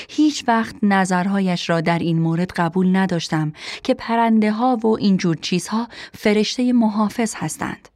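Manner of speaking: plain reading voice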